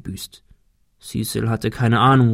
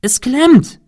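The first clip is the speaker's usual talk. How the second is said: much higher, loud cry; shouting; 14 semitones higher, 6 dB louder than usual. A narrator's reading. Cecil shouting to Thomas that the dish is stuck. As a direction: yell it down from the roof. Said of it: "rief Cecil".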